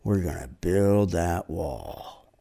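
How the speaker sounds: slow, drawn-out rally line; Trump at church. Retold or imitated. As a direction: drag each word out. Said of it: "And he said".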